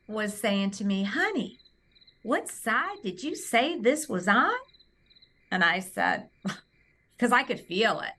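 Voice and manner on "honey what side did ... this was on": put-on higher-pitched, lilting Southern accent. Technician asks the patient which side, in a lilting Southern accent. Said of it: "in her little Southern accent".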